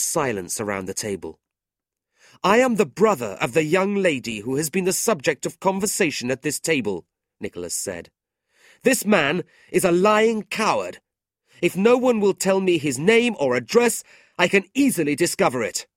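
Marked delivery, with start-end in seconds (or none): 2.4-7.1 high, loud and formal; indignant declaration. A narrator's reading. Brother announcing himself to insulters, indignant. 8.8-15.9 very high, loud outrage; angry denunciation. A narrator's reading. Brother calling man a coward, angry.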